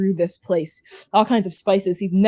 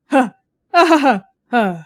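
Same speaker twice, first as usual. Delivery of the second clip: short breathy huh-huh chuckle, lightly voiced; mock chuckle; 3 semitones higher, 3 dB louder than usual. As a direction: chuckle it out like a puzzled gentleman. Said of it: "kinda noise".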